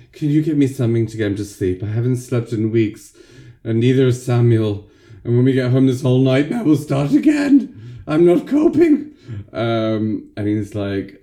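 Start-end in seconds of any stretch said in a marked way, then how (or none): 0.1-9.1 high, fast, desperate pleading; frantic mother. Retold or imitated. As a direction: beg like an exhausted mum at the end of her rope. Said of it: "and then she's like".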